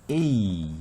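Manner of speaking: A held as a long "ay" sound; long vowel demo. Shown as a demonstration. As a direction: stretch A out to model the long vowel. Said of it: "이렇게 읽고". (A를 에이 하고 길게 발음)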